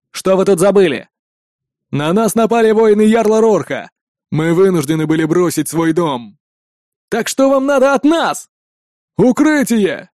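gruff calls over the wall; viking shouting. A narrator's reading. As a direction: shout across the palisade. Shouted exchange over the palisade. (перекличка викингов через частокол)

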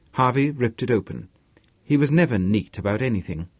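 plain reading voice

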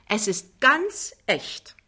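slow, grand boast; proudly drawn-out assertion. A narrator's reading. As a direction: savour each word with pride. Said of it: "sagt Frau Pinneberg stolz".

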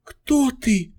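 high, weak moan. A narrator's reading. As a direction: moan the question in pain. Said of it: "простонала волшебница".

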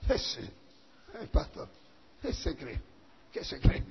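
very soft, half-whispered mockery; hushed imitation of a scoffing listener; inward muttering. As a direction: mutter it to yourself under your breath. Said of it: "Por dentro dice".

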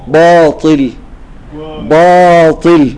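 باطل stretched very slowly with a thinned baa; wrong pronunciation. Shown as a demonstration. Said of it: "فيقول". (نطق خاطئ لكلمة باطل بباء مرققة ممدودة)

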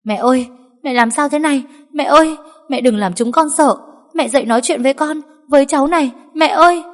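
high, loud, anguished pleading; wailing cry. A narrator's reading. Daughter-in-law feigning grief over the injured mother, wailing. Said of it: "gào thét chạy lại".

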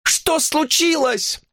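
very high, drawn-out call; frightened woman calling out; alarmed cry. A narrator's reading. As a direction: cry out in alarm, stretching the words. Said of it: "закричала она".

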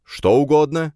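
high, loud, eager declaration; proclaiming. A narrator's reading. Friend promising anything for help, proclaiming loudly. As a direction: proclaim it with gusto. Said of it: "провозгласил он".

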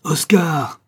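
put-on father bellows the boy's name high and loud; booming angry call to a straying son; thunderous shout. A narrator's reading. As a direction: roar it like thunder across the park. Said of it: "d'une voix de tonnerre".

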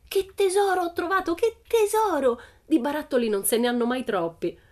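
high, loud rapture, easing on the last sentence; excited cry. A narrator's reading. Pippi holding up an old tin, crying out. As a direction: crow over a treasure at the top of the voice. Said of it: "gridò sollevando dall'erba".

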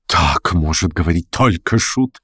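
slow, indignant character voice, mildly heated; flaring up. A narrator's reading. Student rebukes the joker, flaring up. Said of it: "вспыхнула студентка".

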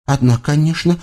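lightly voiced chatty child; a hint of eager child; eager little girl. A narrator's reading. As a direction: answer readily, like a trusting kid. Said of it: "охотно включилась в разговор Дашка".

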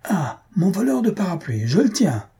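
high-pitched, indignant cry of capture; irascible outburst. A narrator's reading. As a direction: pounce on the thief with an outraged cry. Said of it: "L'irascible portier bondit". (cri irascible et triomphant)

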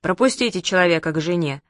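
plain reading voice

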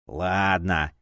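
low, discontented mumble; grudging grumble. A narrator's reading. Fanatic agrees to take the cards, grudging grumble. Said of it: "Недовольно буркнул стоящий рядом".

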